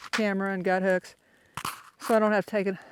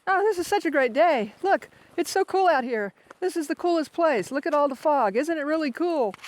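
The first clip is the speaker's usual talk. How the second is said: much higher, bright-eyed gushing about the view; mock cheery excitement; 7 semitones higher, 3 dB louder than usual. Hiker imitating his downhill self, gushing over the fog. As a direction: gush like a kid on a downhill. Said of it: "I start going downhill and it's like".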